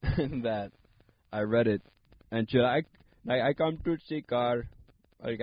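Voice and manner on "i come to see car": mock Indian accent, higher; playful Indian accent imitation. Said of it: "I read it in an Indian accent".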